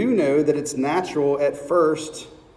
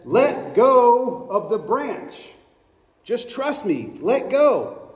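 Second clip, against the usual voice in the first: slow and insistent; 6 semitones higher, slower than usual. Acted. God repeats, slower.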